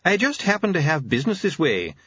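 high, chirpy visitor; cheery, mild-mannered doctor; bright smile. A narrator's reading. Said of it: "smiling brightly".

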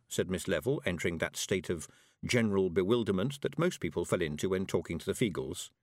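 plain reading voice

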